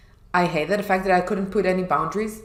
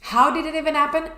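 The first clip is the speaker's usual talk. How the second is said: incredulous; 8 semitones higher, faster than usual. Retold or imitated. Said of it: "And I was like".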